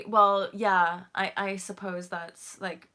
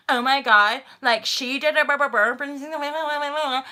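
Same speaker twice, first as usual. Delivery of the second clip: mock dramatic gossip; 5 semitones higher, 8 dB louder than usual. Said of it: "their voice was going".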